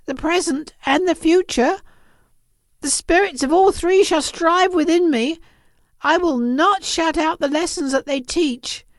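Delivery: high, fervent vow; pleading Scrooge. Acted. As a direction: promise earnestly, begging for another chance. Scrooge vows to reform.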